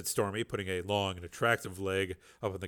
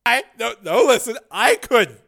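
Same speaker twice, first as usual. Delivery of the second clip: flustered refusal; 12 semitones higher, 12 dB louder than usual. Acted. Tracy refuses the key.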